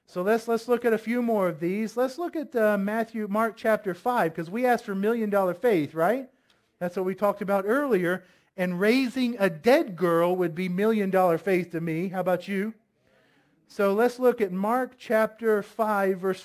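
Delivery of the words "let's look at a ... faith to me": normal talking voice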